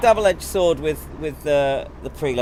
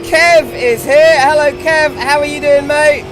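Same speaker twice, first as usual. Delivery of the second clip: cheery greeting; 7 semitones higher, 7 dB louder, slower than usual. Real, not acted. Greeting a viewer who joined.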